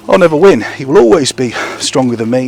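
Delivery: ordinary talk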